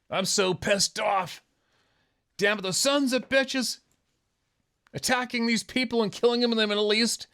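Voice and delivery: high, hammed-up angry whine; mock outrage. Ranter mocks people angry about the Middle East, hammed-up outrage.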